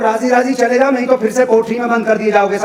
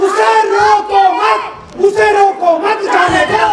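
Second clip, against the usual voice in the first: angry shout; 8 semitones higher, slower than usual. Acted. Father furious, ordering.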